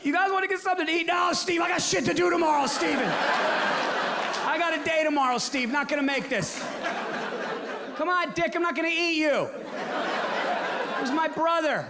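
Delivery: high, laughing excuses to a friend; friends dodging Steve. Acted. Stoner friends dodging Steve Dahmer, laughing high excuses. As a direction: brush him off with nervous laughter.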